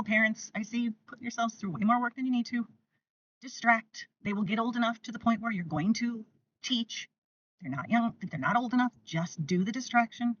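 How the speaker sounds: usual speaking voice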